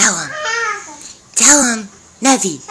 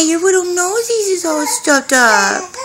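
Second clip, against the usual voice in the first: laughing; 4 semitones higher than usual. Real, not acted. Laughing with baby.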